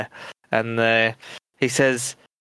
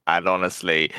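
ordinary talk, nothing marked

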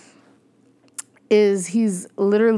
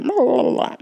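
higher-pitched babbled rewind sound; imitated rewind noise; 6 semitones higher than usual. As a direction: babble like a tape spooling back. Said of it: "we gon' rewind it".

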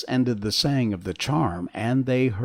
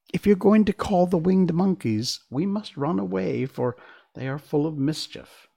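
lightly voiced high mouse queen; small creature, pitch raised; squeaky little voice; 7 semitones higher, 3 dB louder than usual. A narrator's reading. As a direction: warn in a slightly squeaky voice. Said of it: "in her squeaky little voice".

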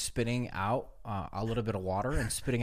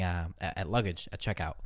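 ordinary delivery

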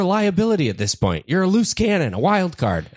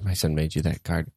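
lower, deadpan guest voice; low odd voice; 10 semitones lower, 6 dB quieter than usual. Acted. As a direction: hand it over with a low deadpan. Repeats the card line.

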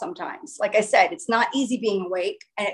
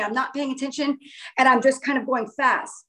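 usual speaking voice

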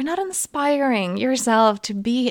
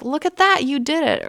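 higher, louder delighted praise to her daughter; excited praise; 6 semitones higher, 3 dB louder than usual. Retold or imitated. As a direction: cheer her on proudly. Praising daughter after swim.

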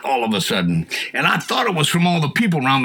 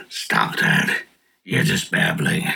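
much lower, breathless menacing voice; other voice; 10 semitones lower, much slower than usual. Retold or imitated. The mocking voice from across the room, low and hushed. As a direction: make it a low, hushed voice from the corner. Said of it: "this other voice".

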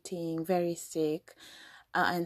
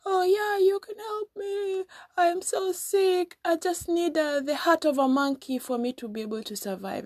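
much higher, hammed-up sickly voice; fake sick; 11 semitones higher than usual. Crocodile wife pretending to be dying to her husband, hammed-up high sick voice. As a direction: play a wife faking illness, pitched way up. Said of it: "fakely sick crocodile was like".

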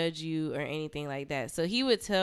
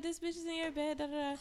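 higher, softer mock whine; mocking the girlfriend; 9 semitones higher, 5 dB quieter, faster than usual. The other girl complaining to him, mocked in a high whine. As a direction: parrot her complaint. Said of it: "She's like".

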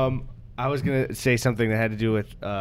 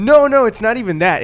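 excited denial; 12 semitones higher, 10 dB louder than usual. Real, not acted. Denying conspiracy accusation.

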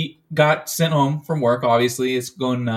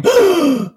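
high, loud startled gasp; shocked gasp; 14 semitones higher, 5 dB louder than usual. Retold or imitated. Standing behind the girl at the bloody house, shocked gasp. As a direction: suck in air in disbelief. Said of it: "also going".

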